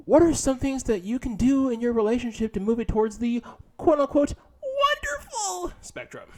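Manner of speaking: high, loud mock-presenter read; hammed-up article reading. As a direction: proclaim it loudly, stretching the quoted word high. Reads article aloud with mock grandeur.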